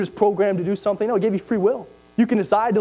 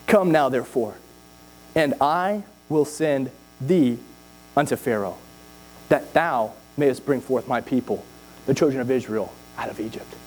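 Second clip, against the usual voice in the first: scripture reading; 8 semitones lower than usual. Acted. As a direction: read it like the voice of God. Low slow scripture reading.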